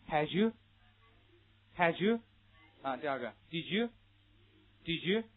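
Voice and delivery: "had you" and "did you" as ha-ju, di-ju; slow drill, d+y as j in "did you"; linked j sound. Shown as a demonstration. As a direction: model "had you" with a blended j for repetition. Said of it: "发生第二种音变发之的这个音".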